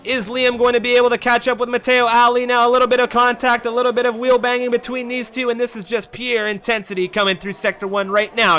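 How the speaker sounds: high, loud, excited race call; half-yell the play-by-play at a sustained high pitch. Real, not acted. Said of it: "kinda half yelling".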